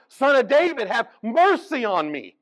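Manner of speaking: loud, high desperate plea; a blind man screaming over the crowd for mercy; wailing cry. Retold or imitated. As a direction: shout it out as if begging to be heard. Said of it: "he's just screaming and wailing out".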